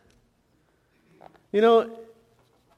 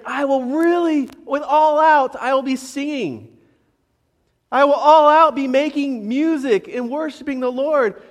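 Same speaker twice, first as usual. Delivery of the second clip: all-out vow; 5 semitones higher, 5 dB louder than usual. Retold or imitated. Voicing David's all-out vow.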